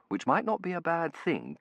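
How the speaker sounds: ordinary delivery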